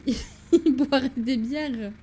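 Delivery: laughing. Real, not acted. Joking about a German Layton.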